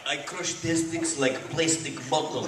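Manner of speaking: put-on Russian accent. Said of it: "He had to go Russian".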